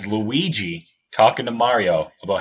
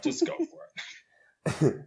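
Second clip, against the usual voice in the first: high, soft, slow silly voice; high, hushed, drawn-out mock encouragement; 8 dB quieter, much slower than usual. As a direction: squeak it out slowly like a joke. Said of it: "repeat in a put-on voice".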